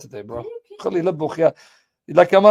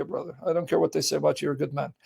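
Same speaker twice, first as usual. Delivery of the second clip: normal talking voice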